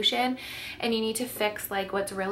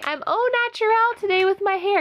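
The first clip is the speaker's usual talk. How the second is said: voicing the baby; 9 semitones higher, 6 dB louder than usual.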